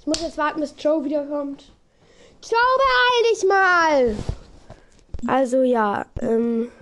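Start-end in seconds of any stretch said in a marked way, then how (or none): 2.4-4.2 calling out. Real, not acted. Calling out to absent friend.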